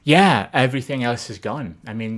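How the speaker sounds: normal talking voice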